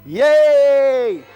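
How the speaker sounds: loud, drawn-out happy cheer. Pretend. Teacher cheers Haman's downfall with children, cheering. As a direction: celebrate with one big cheer.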